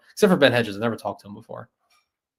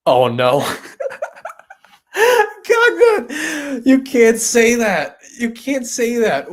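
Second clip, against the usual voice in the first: high, loud, drawn-out protest through laughter; cracking up, squeal the words out slowly; laughing protest; 11 semitones higher, 8 dB louder, much slower than usual. Real, not acted. Streamer scolding a viewer's cheeky chat message, laughing protest.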